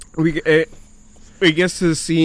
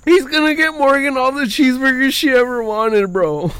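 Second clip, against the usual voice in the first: high mock-weepy wail; exaggerated tearful whine; crying; 11 semitones higher than usual. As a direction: wail it as if overcome. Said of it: "crying, whatever".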